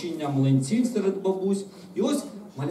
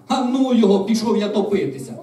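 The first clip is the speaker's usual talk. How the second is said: loud, high, fed-up exclamation; disgruntled outburst; 5 semitones higher, 9 dB louder than usual. A narrator's reading. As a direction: throw it out in mock despair. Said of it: "невдоволений гомін".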